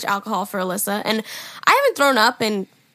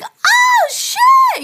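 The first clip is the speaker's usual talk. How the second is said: put-on very high, loud drunken squeal; 8 dB louder than usual. Drunk girl learning of the famous DJ, squealed. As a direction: shriek it in drunken amazement. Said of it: "You know, like, drunk me".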